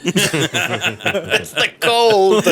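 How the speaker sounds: high, long-drawn comic wail; laughing mock protest. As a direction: stretch it out high through laughter. Joking excuse amid group laughter.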